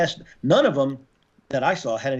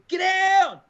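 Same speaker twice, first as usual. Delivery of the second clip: retold shout. Calling out in firefight.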